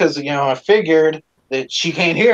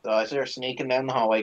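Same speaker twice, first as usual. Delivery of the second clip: ordinary delivery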